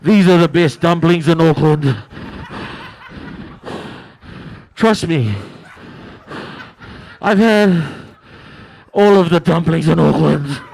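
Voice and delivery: big fat food critic. Retold or imitated. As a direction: boom it out like the fattest food critic. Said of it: "someone who's just like".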